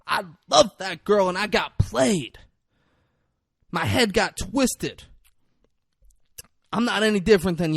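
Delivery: high, loud acted desperate speech; impassioned freak-out. Gunman on the porch addresses onlookers, impassioned freak-out. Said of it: "Mekhi Phifer is freaking out".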